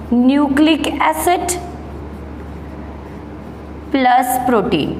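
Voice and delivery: word by word, very slow, for students to write down; slow dictation. Shown as a demonstration. Teacher dictating a definition to the class, very slow. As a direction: dictate each word with long pauses.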